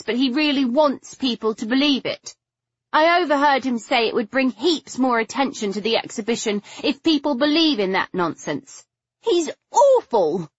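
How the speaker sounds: high, upset, close to tears; voice nearly breaking. A narrator's reading. Figgy accusing Patrick to Stoker, voice nearly breaking. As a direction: pour out the grievance in a high, distressed voice. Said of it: "her voice nearly breaking".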